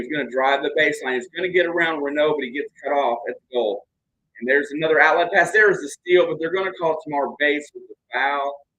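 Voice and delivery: brisk live game commentary; play-by-play. Acted. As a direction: call the action as it happens.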